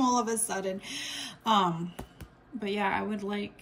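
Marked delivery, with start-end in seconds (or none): none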